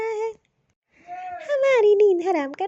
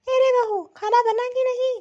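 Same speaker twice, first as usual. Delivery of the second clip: calling out; 4 semitones higher, 4 dB louder than usual. Acted. Mother-in-law calling the daughter-in-law.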